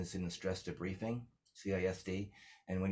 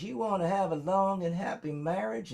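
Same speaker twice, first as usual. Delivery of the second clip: put-on high, sweet old country grandma; high old grandma voice, louder; 12 semitones higher, 5 dB louder than usual. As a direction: put on a much higher little-old-lady voice. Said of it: "she goes".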